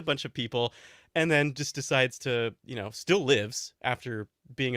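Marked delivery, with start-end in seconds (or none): none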